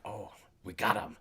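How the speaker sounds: a low, soft aside from the background; parents muttering. Retold or imitated. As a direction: mumble it like a parent filming off to the side.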